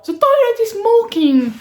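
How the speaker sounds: put-on high, slow, alarmed child voice in English; meme kid voice. Quoting a viral meme kid, high and slow. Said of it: "C'est pas genre".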